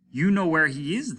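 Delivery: loud, high accusation; angry loud demand. A narrator's reading. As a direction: bark it at him. Captain demands.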